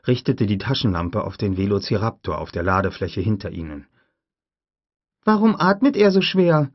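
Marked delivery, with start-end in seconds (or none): 5.2-6.7 put-on much higher, louder child voice; a curious little girl asking; young girl voice. Lex asks Grant about the raptor, high child voice.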